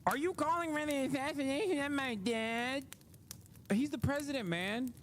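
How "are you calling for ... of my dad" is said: high, mocking whiny grown son. Mocking Don Junior to the chat, high and whiny. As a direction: whine it like an offended child. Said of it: "you can't be like".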